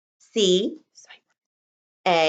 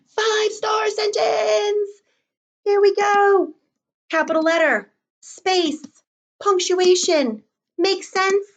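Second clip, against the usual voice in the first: excited checklist; 11 semitones higher than usual. Shown as a demonstration. Upbeat checklist for kids.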